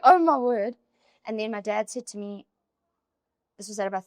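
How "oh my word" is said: louder, higher mock-overwhelmed cry; acted-out flustered outburst; overwhelmed exclamation. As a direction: exclaim it as if overloaded with emotion. Acting out being overstimulated by emotions.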